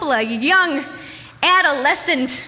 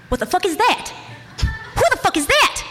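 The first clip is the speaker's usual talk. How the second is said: mock alarm, building higher; re-enacted rising alarm; 6 semitones higher than usual. Teller re-enacts hearing the sink run, rising alarm. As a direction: start startled, climb to higher panic.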